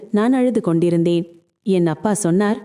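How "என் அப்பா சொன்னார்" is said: usual speaking voice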